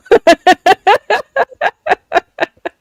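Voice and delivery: long, loud, high-pitched laughing fit; real belly laugh. Real, not acted. Guest cracks up after admitting to rude comments, real loud laugh. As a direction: burst out laughing at your own answer. Laughs at own shadow-ban answer.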